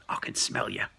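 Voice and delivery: very low, menacing goblin voice; menacing goblin growl. Acted. Goblin threatens the friend behind the rock, deep and menacing. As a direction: drop deep and threaten like a monster.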